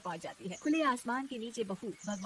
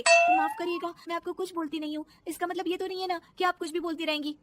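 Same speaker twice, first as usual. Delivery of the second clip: high, raised protest; indignant retort; 7 semitones higher, 5 dB louder than usual. Acted. Daughter-in-law answers back to scolding mother-in-law, indignant. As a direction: stand up to her firmly.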